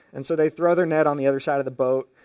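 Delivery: usual speaking voice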